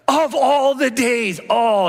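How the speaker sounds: high, slow mock despair; hammed-up "why me" groan up high; comic cry of disbelief, pitched well up; exasperated wail. Car stolen, exasperated quote.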